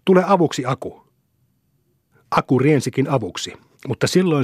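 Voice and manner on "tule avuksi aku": higher-pitched call, dropping at the end; mild cry for backup; calling for help. A narrator's reading. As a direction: call a friend over to help. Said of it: "oli jo pakotettu huutamaan".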